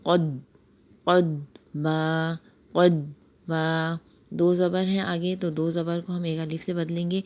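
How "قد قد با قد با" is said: قد with a firm, stopped dad; قد، با with a clear dad; dad saakin. Shown as a demonstration. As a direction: hit the dad in قد hard and hold it. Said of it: "ضاد ساکن کی ادائیگی اس طرح ہوگی".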